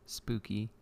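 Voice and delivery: ordinary talk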